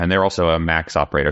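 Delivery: ordinary talk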